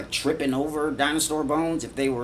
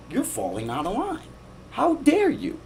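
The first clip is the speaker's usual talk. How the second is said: high, indignant mock scold at the questioner; mock outraged person; 12 semitones higher, 3 dB quieter than usual. A believer scolding the person who questioned him, high mock outrage. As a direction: play someone offended their belief was questioned, pitched way up. People challenged on their beliefs.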